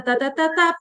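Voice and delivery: normal talking voice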